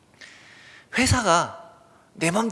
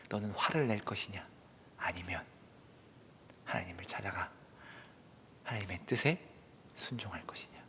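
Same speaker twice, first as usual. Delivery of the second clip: hushed, low voice with pauses; low, subdued searching question; solemn questioning; 8 semitones lower, 14 dB quieter than usual. Acted. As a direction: ask very softly, phrase by phrase. God's closing question to the listeners.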